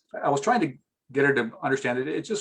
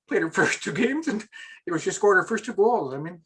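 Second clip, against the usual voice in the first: high, strained voice near tears; fighting back tears; choked up; 6 semitones higher than usual. Real, not acted. Emotional parent near tears.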